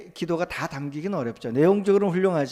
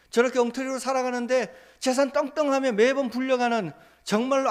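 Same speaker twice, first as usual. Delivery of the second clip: fervent prayer; 7 semitones higher than usual. Acted. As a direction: lament injustice to God at full voice. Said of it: "라는 기도를".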